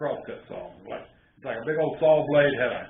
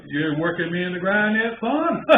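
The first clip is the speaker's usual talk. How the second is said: louder, rising teasing question; teasing grandpa; 6 semitones higher, 5 dB louder than usual. Retold or imitated. Grandpa teasing grandson during sawing, louder and rising. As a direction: rib the boy about his boast.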